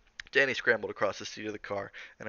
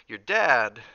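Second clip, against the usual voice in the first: loud, stretched "Your dad", then a halt; broken-off pause; 2 semitones higher, 12 dB louder than usual. A narrator's reading. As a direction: lean on "dad" and leave it hanging. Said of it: "Halloran paused momentarily".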